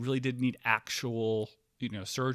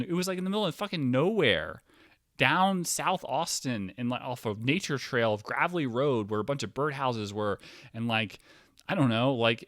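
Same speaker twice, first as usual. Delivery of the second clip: heated rant; 6 semitones higher, 5 dB louder than usual. Real, not acted. Ranting, heated.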